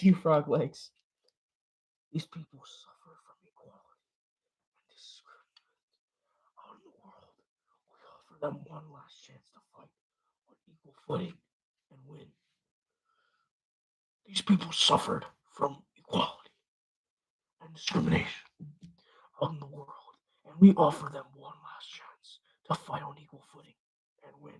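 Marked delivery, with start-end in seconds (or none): 2.1-12.3 hushed, breathy trailer line; whispered recital. Acted. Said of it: "your all's theories". 14.3-24.5 hushed voiceover read; whispered recital. Acted. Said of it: "your all's theories".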